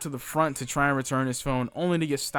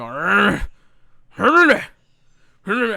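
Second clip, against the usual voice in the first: loud growl rising much higher; angry growl; 6 semitones higher, 10 dB louder, much slower than usual. Retold or imitated. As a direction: growl like an angry old man. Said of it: "He go".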